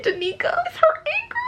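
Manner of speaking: very high weepy squeal; tearfully overwhelmed. Real, not acted. Reader stunned by the final twist, tearful. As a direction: climb into a high, crying squeak.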